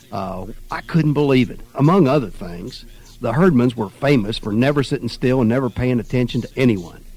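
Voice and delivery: usual speaking voice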